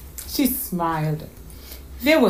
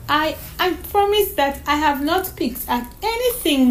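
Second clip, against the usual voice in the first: put-on high, halting child's promise; little girl's voice, stumbling to reassure; earnest little girl; 5 semitones higher than usual. Voices young Donna May in story.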